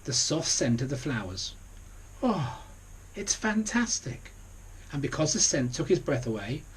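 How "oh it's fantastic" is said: 1 delighted sigh. A narrator's reading. Pig smells the flowers.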